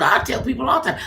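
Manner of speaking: normal talking voice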